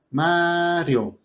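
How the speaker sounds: "Mario" with a long, high A, then falling; stress on the A. Shown as a demonstration. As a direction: stretch the first syllable, MAAA-rio. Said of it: "la fuerza de la voz está cayendo en la A".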